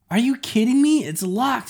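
high, loud exasperated yell; frustrated shout. Acted. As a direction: shout it at the locked door. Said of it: "she shouted".

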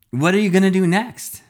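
high, loud pushy reporter voice; eager reporter. A narrator's reading. Reporters firing questions at hero.

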